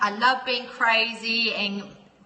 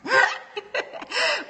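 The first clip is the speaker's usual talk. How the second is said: real high-pitched giggling fit; amused laugh; 11 semitones higher than usual. Real, not acted. Laughing at a troll.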